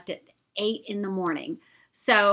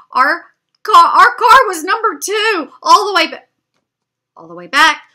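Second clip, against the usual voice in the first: much louder and higher, near a shout; panicked realization; 9 semitones higher, 14 dB louder than usual. Retold or imitated. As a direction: blurt it out in alarm as the mistake hits. Realizing they're at the wrong car.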